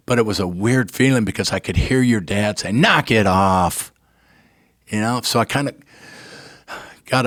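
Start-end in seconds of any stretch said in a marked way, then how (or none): 2.7-3.9 slow, deliberate "knock it off" in the friend's voice; lightly voiced stern buddy; imagined friend telling him to stop crying; gruff friend's voice. Hearing dead friend's voice in head.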